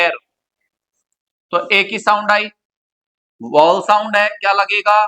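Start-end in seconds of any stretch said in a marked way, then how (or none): 0.0-0.1 "एर" begins straight on the vowel; silent h in "heir". Shown as a demonstration. Teacher gives heir's pronunciation, "एर" with no h sound. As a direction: say "एर" like "air", h dropped. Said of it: "लेकिन इसका pronunciation होता है".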